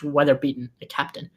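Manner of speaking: ordinary talk, nothing marked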